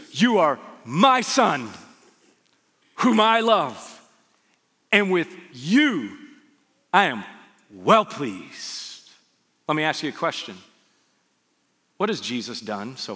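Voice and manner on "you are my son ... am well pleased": loud, slow declaration with big swells; proud proclamation. Acted. God to Jesus at his baptism, proud booming proclamation. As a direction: proclaim it in weighty separate phrases.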